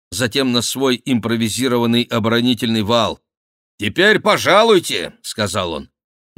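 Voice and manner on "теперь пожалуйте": high, loud taunting invitation; вызов врагам. Acted. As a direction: call the challenge out high. Бюсси дразнит нападающих. (громкий насмешливый вызов)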